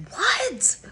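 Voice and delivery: sharp high yell, re-enacted. To sister banging on the door, exasperated yell. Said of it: "And I yelled at her".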